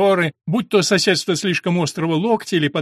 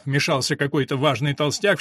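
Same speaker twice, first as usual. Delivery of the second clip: ordinary delivery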